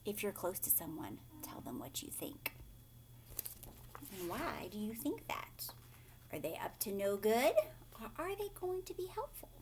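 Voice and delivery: soft whispered questions to the listener; whispered aside. Shown as a demonstration. Storyteller asking the child listening, whispered aside.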